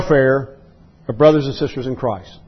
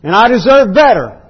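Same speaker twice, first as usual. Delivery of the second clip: higher, louder, indignant demand; indignant "I've got rights" voice; 7 semitones higher, 4 dB louder than usual. Retold or imitated. To a brother who wronged him, an indignant entitled protest. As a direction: play the entitled believer insisting on his rights. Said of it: "we've already answered that why not".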